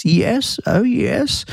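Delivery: high, plummy posh telephone voice; posh Jaguar voice. Retold or imitated. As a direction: put on a posh phone voice. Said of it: "to put on this posh Jaguar voice".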